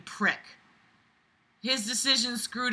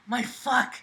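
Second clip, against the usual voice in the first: ordinary talk, nothing marked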